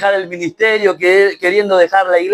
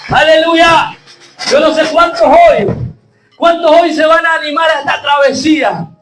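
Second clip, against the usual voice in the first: rising shouted sermon, fervent and pushing; preaching at full force; 6 semitones higher, 3 dB louder than usual. Acted. Pastor rallying his church, shouting fervently. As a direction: hammer it home to the congregation.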